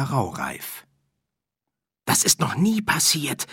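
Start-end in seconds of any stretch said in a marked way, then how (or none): 2.1-3.5 worried. Acted. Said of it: "bemerkte der oberste Hirte besorgt".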